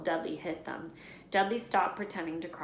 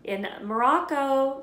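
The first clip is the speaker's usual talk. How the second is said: snapping aunt; 8 semitones higher, 9 dB louder, slower than usual. A narrator's reading. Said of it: "snapped Aunt Petunia".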